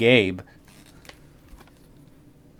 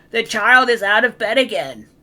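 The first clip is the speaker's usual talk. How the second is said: high, louder bright monster voice; eager monster; 13 semitones higher, 10 dB louder than usual. Acted. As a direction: answer like a teacher's pet. Red monster answering eagerly.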